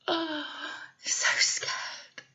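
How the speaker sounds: scared whisper with a sob. Shown as a demonstration. Showing the child a scared face, whispered and soft. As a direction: whisper it like a frightened little one. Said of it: "Can you show me your scared face?".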